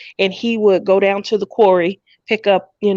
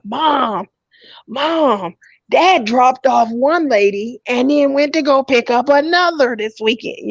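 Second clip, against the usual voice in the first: imitating excited kids; 5 semitones higher than usual. Kids reporting dad's dates.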